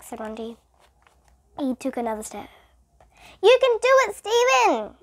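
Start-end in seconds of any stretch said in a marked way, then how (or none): 3.4-5.0 high, loud cheer. A narrator's reading. Classmate encouraging Stephen, high loud cheer. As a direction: cheer him on across the room. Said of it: "cheered Kathy".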